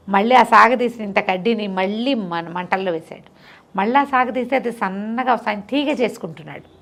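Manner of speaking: plain reading voice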